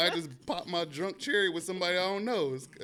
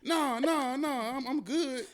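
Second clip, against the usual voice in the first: high reassuring; 10 semitones higher, much slower than usual. Retold or imitated.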